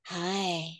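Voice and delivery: put-on light breathy baby doll hi; a hint of a soft baby doll voice. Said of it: "she sort of talks like a baby doll".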